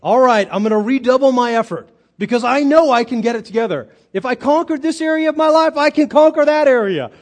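high, loud rallying declaration; self-confident resolve. Acted. Preacher voicing what Paul doesn't say, boldly self-reliant. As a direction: build to a loud, cocksure vow. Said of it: "He doesn't say".

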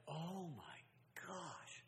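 breathless whisper of shock; hushed gasp. Retold or imitated. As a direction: whisper it, stunned by the story. Brother tells of thirst ordeal.

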